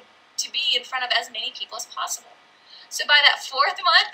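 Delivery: usual speaking voice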